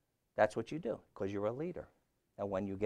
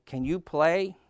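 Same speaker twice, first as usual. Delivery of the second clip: higher, louder storybook call; crying out; 6 semitones higher, 5 dB louder, slower than usual. A narrator's reading. Toad and Frog in the theater, crying out. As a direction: call the question out across the theater. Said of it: "Frog cried".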